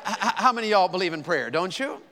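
ordinary talk, nothing marked

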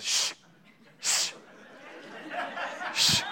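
sharp hissed shushes, spaced out; impatient hushing, acted out; mock husband's shush. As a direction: shush someone talking over the show. Husband hushing his talking wife.

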